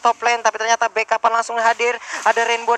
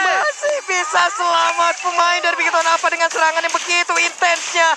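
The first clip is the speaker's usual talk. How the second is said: excited play-by-play; 5 semitones higher than usual. Real, not acted. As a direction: ride the fight's peak, pitch up. Caster hypes intense teamfight.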